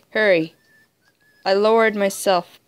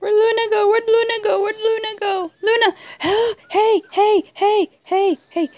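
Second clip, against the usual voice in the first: worried high calling; 9 semitones higher, much faster than usual. Real, not acted. Searching frantically for Luna.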